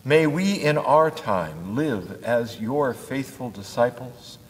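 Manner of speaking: ordinary talk, nothing marked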